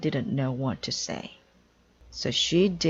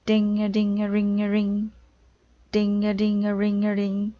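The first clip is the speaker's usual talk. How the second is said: loud high sing-song ringing; triangle ding imitation; 5 semitones higher, 5 dB louder than usual. Amelia hitting the triangle hard, loud ringing. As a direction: ring it out like a struck triangle.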